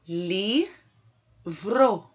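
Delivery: "Livro" split into li, vro; sílaba por sílaba. Shown as a demonstration. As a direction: pause after li, then vro, for "Livro". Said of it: "basta separar em sílabas". ("Livro" separado em li e vro)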